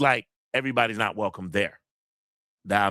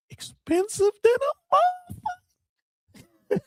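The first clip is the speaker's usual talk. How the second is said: mock-shocked high squeal over ticket prices; comic squeal; 3 dB louder than usual. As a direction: voice jumps sky-high on expensive, mock outrage. Joking about pricey tickets.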